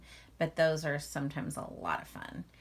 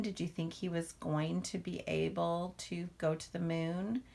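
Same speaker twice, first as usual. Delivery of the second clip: ordinary delivery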